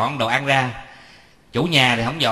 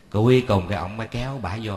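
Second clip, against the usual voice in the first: ordinary talk, nothing marked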